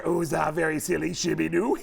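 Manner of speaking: light silly Sandler voice; a hint of Sandler silliness; Adam Sandler impression. Shown as a demonstration. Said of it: "Adam Sandler".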